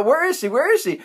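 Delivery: high, worried calling; mock panic over a missing child; acted frantic search.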